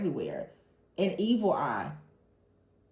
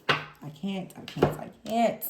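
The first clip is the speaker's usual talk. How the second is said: normal talking voice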